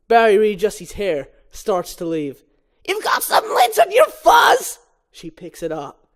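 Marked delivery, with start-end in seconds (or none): 2.9-4.8 high, loud shrill mom voice; fussing mother. Acted. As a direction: shriek it out much higher.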